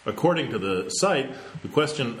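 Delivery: ordinary talk, nothing marked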